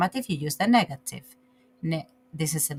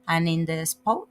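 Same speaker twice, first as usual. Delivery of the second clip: normal talking voice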